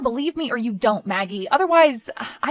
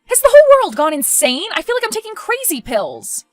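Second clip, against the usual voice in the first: loud, high exasperation; outraged at the madness of it all; incredulous outburst; 9 semitones higher, 7 dB louder than usual. Acted. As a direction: explode in disbelief, pitch way up. Daughter outraged at being evicted.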